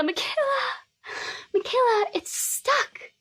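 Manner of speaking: high, strained desperate call; panicked plea. Retold or imitated. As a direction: beg for help, voice climbing.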